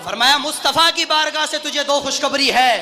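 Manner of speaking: loud, high, driving pulpit oratory; full-force preaching. Retold or imitated. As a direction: hammer each phrase, building to a shouted climax.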